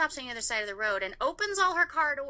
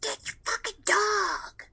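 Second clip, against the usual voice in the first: imitating a neighbor's high, furious shout; angry yell; 10 semitones higher, 3 dB louder than usual. Neighbor yelling at the teller about her dog, angry high shout. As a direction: snap it out like you're storming across the yard. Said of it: "she comes running out there".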